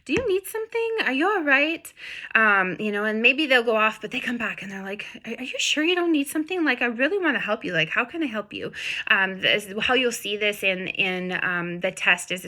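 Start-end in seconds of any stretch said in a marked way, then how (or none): 0.0-8.7 much higher, eager and concerned; a puppy desperate to help; high anxious helper dog voice; voicing a worried dog. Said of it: "the dog is like".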